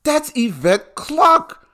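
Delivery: high, slow, stretched-out recognition; drawn-out "Yo, that's Yvette Clarke"; excited callout. Retold or imitated. Telling his friend he spots the billboard, excited. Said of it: "And I said".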